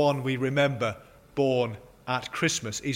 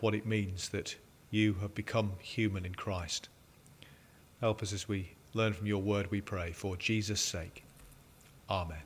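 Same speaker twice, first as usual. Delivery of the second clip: soft, low-pitched prayer; hushed, measured praying voice; public prayer; 5 semitones lower, 7 dB quieter than usual. Real, not acted. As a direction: lead a congregation quietly in prayer. Leads the opening prayer.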